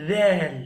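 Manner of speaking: tongue tip to the teeth for "zal"; zal demo. Shown as a demonstration. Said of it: "zal को हमने कैसे पढ़ा". (ज़ोर से "zal")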